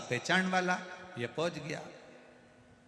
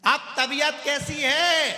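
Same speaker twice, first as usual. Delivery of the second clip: very loud, high, slow question; deaf man shouting; 12 semitones higher, 11 dB louder, slower than usual. Retold or imitated. Deaf visitor shouts a slow high question at the patient. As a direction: bellow the question slowly.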